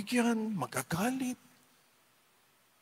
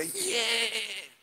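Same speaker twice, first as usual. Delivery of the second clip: mock cheer; 6 semitones higher, 4 dB quieter than usual. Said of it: "Maingay ka talaga".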